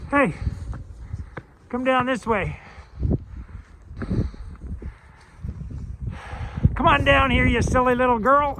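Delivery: high, loud call to the dog; coaxing sing-song call. Real, not acted. Calling the dog down.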